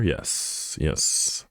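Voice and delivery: hushed, voiceless confirmation; soft whispered aside; whispered yes. Real, not acted. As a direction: whisper it to yourself. Whispered aside while checking something.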